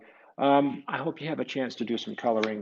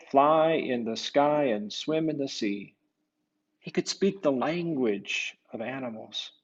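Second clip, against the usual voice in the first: storybook narration; 2 semitones higher, 6 dB louder than usual. A narrator's reading. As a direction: read it like a bedtime tale to children. Story narration read aloud.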